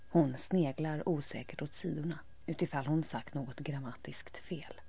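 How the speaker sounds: ordinary delivery, nothing marked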